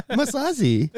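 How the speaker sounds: high-pitched fake Japanese accent; mock Japanese accent. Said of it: "give us a little Japanese".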